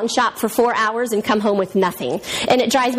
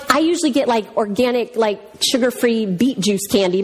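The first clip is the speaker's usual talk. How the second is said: ordinary delivery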